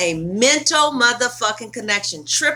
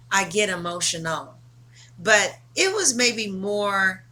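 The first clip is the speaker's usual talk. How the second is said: ordinary talk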